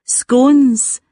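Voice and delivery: "Scones" said to rhyme with stones, long o. Shown as a demonstration. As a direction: draw out "Scones" with an English long o. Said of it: "said like stones".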